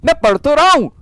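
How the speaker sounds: high, insistent mock student claim. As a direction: play the student swearing he is studying. Mimics dreaming student.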